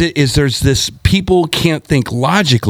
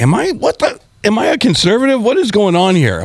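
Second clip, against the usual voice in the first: high, stunned self-questioning; shocked disbelief; 10 semitones higher than usual. Retold or imitated. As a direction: gasp it out in disbelief. Retelling his own shocked realization.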